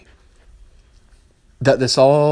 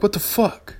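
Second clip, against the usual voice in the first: mock outrage; 9 semitones higher than usual. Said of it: "I'm like".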